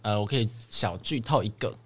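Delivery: usual speaking voice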